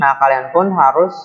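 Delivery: ordinary talk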